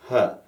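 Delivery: an easy little schwa, let out briefly; short "huh" placed forward in the mouth; simple short "huh". Shown as a demonstration. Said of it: "simple sound like that".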